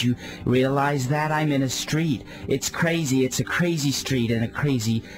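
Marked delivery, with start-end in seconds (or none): none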